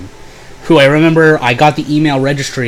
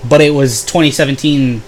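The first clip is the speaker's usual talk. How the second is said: ordinary delivery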